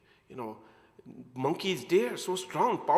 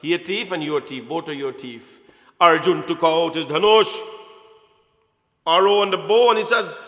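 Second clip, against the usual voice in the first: ordinary talk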